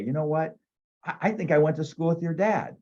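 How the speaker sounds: imagine the kind answer; imagined friendly reply. Pretend. Imagining the homeowner greeting him warmly, hopeful.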